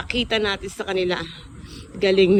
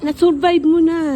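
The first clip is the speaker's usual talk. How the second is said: higher and louder, cheering her on; bright encouragement; 8 semitones higher, 6 dB louder than usual. Real, not acted. Child cheers recovering mother, loud high encouragement. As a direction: lift her spirits with a burst.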